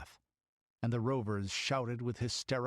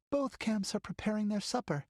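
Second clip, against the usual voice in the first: put-on a girl's voice, much higher; small girl gazing at sunset; young girl; 11 semitones higher than usual. Girl at the window describes sunset, girlish voice.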